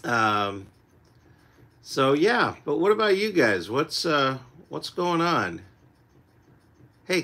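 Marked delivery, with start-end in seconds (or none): none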